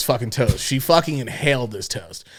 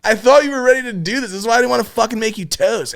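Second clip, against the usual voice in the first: higher, exasperated scolding; exasperated rant; 7 semitones higher than usual. Retold or imitated. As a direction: vent at her, fed up. Retold angry rant at the girl.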